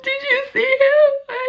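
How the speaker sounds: very high, slow, squealed wail; drawn-out mock happy crying; hammed-up bawling. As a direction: re-enact sobbing to friends in disbelief. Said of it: "I was full on crying".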